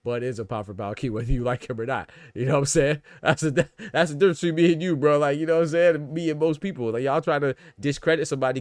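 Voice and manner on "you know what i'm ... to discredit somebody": louder and higher, fired up at chat; worked up arguing Bud's record; heated. Real, not acted. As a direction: raise your voice and call out the doubters.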